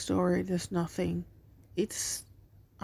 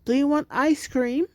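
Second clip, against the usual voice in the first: calling out; 6 semitones higher, 5 dB louder than usual. Acted. Mom calls hidden kids.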